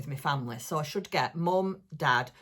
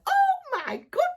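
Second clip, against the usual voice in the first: "Oh my goodness" squealed very high and loud; 8 dB louder than usual. Retold or imitated. Vlogger retelling her squeal when she opened Dawn's parcel. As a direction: replay the delighted squeal from opening the parcel. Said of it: "I opened it yesterday and squealed".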